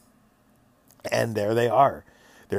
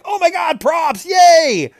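squealed high and loud, delighted; super excited; 11 dB louder than usual. Retold or imitated. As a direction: shriek with glee over the props. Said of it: "Super excited".

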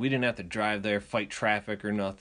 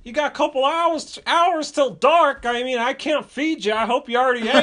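high, loud put-on dad voice; hint pointedly that guests won't be fed; passive-aggressive; 13 semitones higher, 6 dB louder than usual. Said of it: "It's so passive-aggressive".

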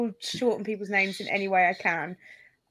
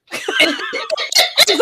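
high burst of laughter mid-sentence; laughing; 5 dB louder than usual. Real, not acted. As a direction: laugh through the words.

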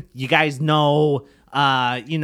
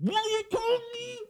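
high, distant shout from outside; calling from far off; 5 dB quieter than usual. Pretend. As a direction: call out from the doorway. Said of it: "What's he screaming for".

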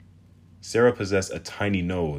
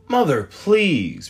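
exasperated; 12 semitones higher, 10 dB louder, much slower than usual. Acted. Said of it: "Bryn rolled her eyes".

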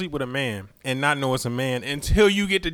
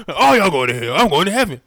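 [name] preacher voice; 4 semitones higher, 7 dB louder than usual. Retold or imitated. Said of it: "D M X".

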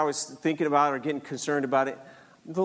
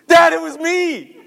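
loud, high, drawn-out confession; blurted confession; 12 semitones higher, 12 dB louder than usual. Retold or imitated. Son finally owns up to dad, loud blurted confession. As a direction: burst out with it, much louder and higher.

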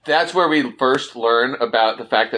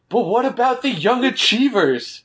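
Kyle mocked in a much higher kid voice; whiny kid; 6 semitones higher than usual. Recapping Kyle's complaint to Bonnie, in a high mocking kid voice. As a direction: pitch up high to mock a whiny boy.

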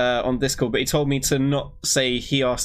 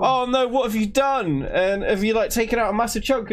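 hammed-up worry, pitched high; mock panic; 8 semitones higher than usual. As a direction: act out panicking in the chair. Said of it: "I'm like".

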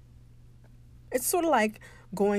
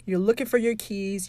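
ordinary talk

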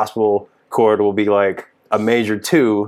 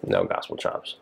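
much lower and softer echo of "No gospel chops"; low mutter; 14 semitones lower, 9 dB quieter than usual. Real, not acted. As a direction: drop it low and quiet to yourself. Repeats the phrase, muttered.

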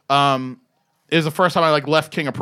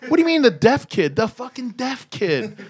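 higher, disbelieving echo; mock-exasperated, pitched up; retold incredulity; 7 semitones higher than usual. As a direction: throw it back as a baffled question, then drag it out. Retold incredulous repetition of question.

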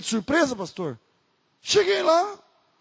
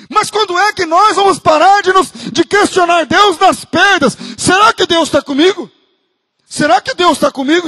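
loud, higher, mocking the doubter's plea; a pastor loudly parodying a doubting believer; full-force preaching; 6 semitones higher, 8 dB louder than usual. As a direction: shout the doubts back at the church.

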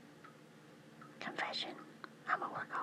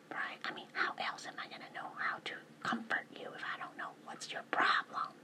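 whispering; 4 dB louder than usual. Real, not acted. Whispers through most of her talk.

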